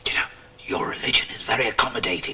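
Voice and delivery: hushed, whispered remark; dry whisper. Acted. Father to housekeeper while hiding from caller, whispering. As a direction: whisper it back under cover.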